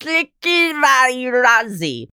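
high, stretched "Parasitivirazi", syllable by syllable. Shown as a demonstration. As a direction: draw out "Parasitivirazi" in a high singsong. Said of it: "how do you say that again".

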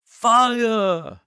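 high, loud cry of fire; yelled. A narrator's reading. Holmes urging the men to cry out, loud shout. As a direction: shout the alarm at full force. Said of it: "The shout must have rung over Norwood".

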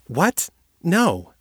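sudden high startled no; shocked denial, pitched high; surprised protest. A narrator's reading. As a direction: cut her off in disbelief. Kate surprised.

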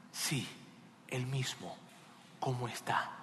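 soft, low, halting reply; hushed insistence. Acted. King asks after his rebel son, hushed. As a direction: answer quietly, sinking to a near whisper.

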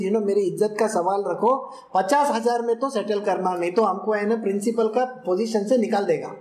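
very fast Hindi haggle; rattled-off bargaining. Retold or imitated. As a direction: gabble the deal like a fish market seller. Principal bargaining in rushed Hindi.

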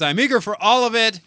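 delighted listener voice; eager fan. Acted. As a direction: gush it like a thrilled fan. Reading fan comment excitedly.

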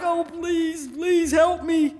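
slow, comic begging; playful plea for rescue; mock pleading. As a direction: plead under your voice, stretching the last words. Silently begging the professor.

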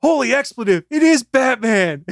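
high, loud astonished cry; amazed exclamation. Retold or imitated. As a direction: act out a stunned crowd's reaction. Retold amazed crowd reacting to Batman.

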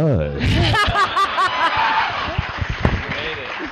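real long burst of laughter. Real, not acted. Laughs at latecomer's entrance.

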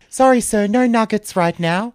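high, loud and flirty, all breathy charm; sexy shop worker. Acted. Shop worker flirting with the nugget robber, sultry and high. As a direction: purr it like she fancies the robber. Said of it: "doing it so sexily".

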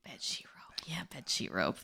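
soft whisper. Real, not acted. Whispering choice.

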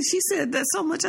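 ordinary talk

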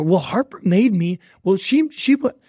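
high-pitched, stammering mock kid excuse; child making excuses. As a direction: imitate a kid protesting and passing blame. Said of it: "If the first words out of your mouth are".

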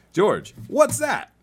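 high game-show call; high singsong. Acted. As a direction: sing it up high for the kid. Quiz game.

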